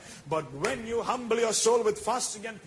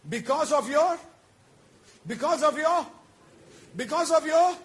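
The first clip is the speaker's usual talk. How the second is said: rising emphatic repetition; 7 semitones higher, 7 dB louder than usual. Real, not acted. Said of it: "preacher building emphasis".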